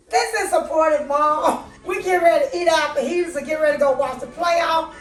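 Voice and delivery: upbeat host outro. Acted. Vlog outro.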